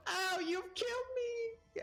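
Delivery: very high, slow mock wail of pain; bell's cry. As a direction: wail like a dying bell. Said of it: "you hear a voice go".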